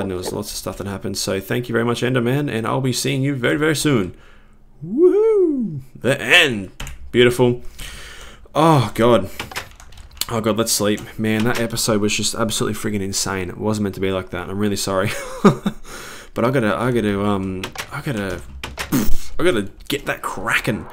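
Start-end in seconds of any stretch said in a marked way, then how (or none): 4.8-5.8 very high, sustained cheer; high woohoo whoop. Real, not acted. Player celebrates the end, high whoop. 15.2-16.3 brief chuckle breaking out; short laugh. Real, not acted. Player apologises to viewers, short laugh.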